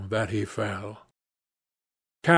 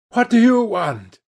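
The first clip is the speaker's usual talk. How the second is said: higher-pitched startled question; mild gasp; 8 semitones higher, 4 dB louder than usual. A narrator's reading. Victim to his attacker at gunpoint, mildly gasping. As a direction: gasp it with a touch of alarm. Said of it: "he gasped".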